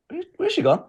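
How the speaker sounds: high, bewildered question; looking round for someone who vanished; baffled. Retold or imitated. The guide vanishes, friend asks in high bewilderment.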